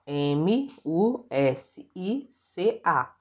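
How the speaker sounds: M U S I C A spelled letter by letter, slowly; teacher spelling aloud. Shown as a demonstration. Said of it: "Uma consoante e uma vogal".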